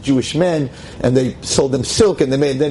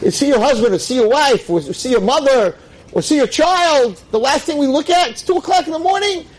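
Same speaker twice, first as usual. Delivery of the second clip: rising preacher cry; 10 semitones higher than usual. Real, not acted. Preacher scolding the audience about late-night phones, climbing high.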